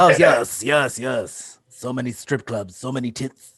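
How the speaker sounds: lightly put-on German accent; a hint of a German accent on request. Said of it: "explain this in, in a German accent".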